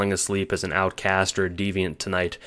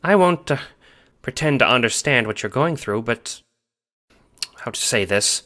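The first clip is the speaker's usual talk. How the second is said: stern Nana; 4 semitones higher than usual. A narrator's reading. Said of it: "voice stern".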